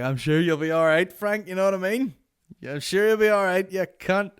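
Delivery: high, loud, cocky send-off; mock cheery farewell. Comedian leaving Frank's room, high cocky send-off. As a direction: cheekily wish him luck. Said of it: "I was like".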